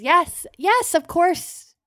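high, mustered-up brightness; forced cheerfulness. Acted. Chalice hiding her doubts from Jalbert, forced cheerful. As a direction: accept with a big put-on smile. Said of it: "musters up a big smile".

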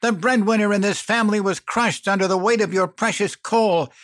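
high, loud, cutting accusation; spitting anger. A narrator's reading. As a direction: hurl the questions at him in fury. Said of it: "She spat".

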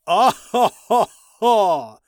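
loud booming evil cackle; evil villain laugh. Acted. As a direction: cackle like a gloating ice king. Villain king laughs at captives.

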